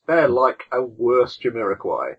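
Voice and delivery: usual speaking voice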